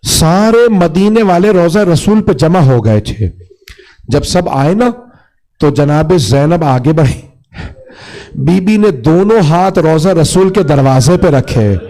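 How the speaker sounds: grieving sermon delivery; mourning narration. A narrator's reading. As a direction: tell the tragedy with mournful weight.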